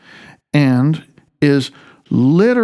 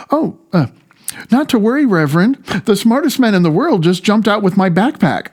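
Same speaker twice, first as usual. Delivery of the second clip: put-on young boy scout; 7 semitones higher than usual. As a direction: cheerfully reassure the priest like an eager kid. Boy scout voiced in joke.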